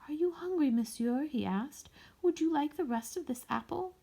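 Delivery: put-on high, gentle child's voice; small boy. A narrator's reading. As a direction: shyly offer the apple. Small boy offers apple.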